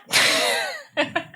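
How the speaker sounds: helpless high giggle; high squeal of laughter. Real, not acted. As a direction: crack up at your own attempt. Fails saying guest's name.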